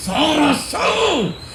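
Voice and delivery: slow, very high drawn-out enraged yell; furious shout. Retold or imitated. Father pinning his son down, screaming in fury. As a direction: bellow it like a father gone wild with rage.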